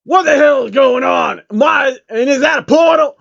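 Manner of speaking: high, loud mock-panicked shout; hammed-up panicked rapper. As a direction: yell in alarm at the portal.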